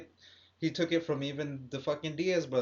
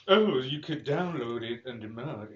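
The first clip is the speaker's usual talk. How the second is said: slow, lower, boring gamer-news voice; mock professional presenter; 4 semitones lower than usual. Podcaster mocking game news videos, slow and professional. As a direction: imitate an overly professional video host, slowly. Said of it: "you're all professional".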